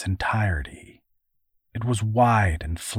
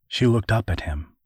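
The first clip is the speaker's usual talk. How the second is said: ordinary delivery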